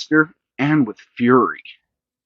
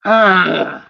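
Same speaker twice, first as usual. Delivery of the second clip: high loud frustrated ugh; groan; 8 semitones higher, 5 dB louder than usual. A narrator's reading. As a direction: groan it out in disgust. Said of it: "groaned Sammy".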